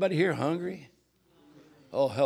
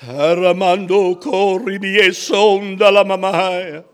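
loud, slow, drawn-out ecstatic chant; praying in tongues; 3 semitones higher, 14 dB louder, much slower than usual. Real, not acted. Preacher praying aloud in tongues before the congregation, loud and fervent. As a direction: hold the syllables long at full voice.

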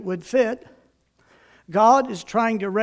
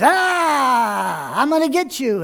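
mock monster yell, raised pitch; playful boogeyman; 4 semitones higher, 4 dB louder, slower than usual. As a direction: pretend to chase the kids, roaring. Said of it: "I'm the bad guy".

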